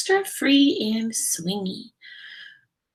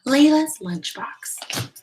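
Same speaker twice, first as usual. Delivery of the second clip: slow, higher title announcement; title read; 6 semitones higher, 3 dB louder, slower than usual. Acted. Teacher announcing the title to the class, slow and bright. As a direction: announce the book title slowly.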